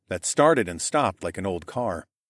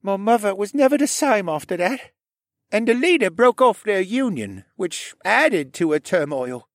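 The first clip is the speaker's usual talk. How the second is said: much higher, louder voice of a troubled man; troubled character's confession; 12 semitones higher, 4 dB louder than usual. Acted. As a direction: pitch it high and strong, recounting a painful past. Voices troubled character telling his past.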